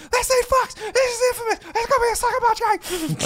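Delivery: retelling the moment he recognized the voice, squealing high; excited squeal. Retold or imitated. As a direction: squeal it like a thrilled fan.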